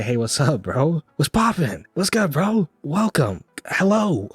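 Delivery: high, loud, breezy "what's good, bro" hype greeting; playful hyped-up hello to shoppers; casual bro greeting. Pretend. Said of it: "I just wanna say".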